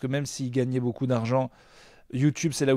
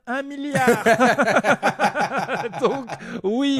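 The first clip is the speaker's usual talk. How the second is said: laughing boast; 8 semitones higher, 5 dB louder, much slower than usual. Pretend. Mock boast, laughing high.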